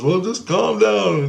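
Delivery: high, slow placating voice; imitated soothing. Grace soothes the still angry dreamer, high slow imitation.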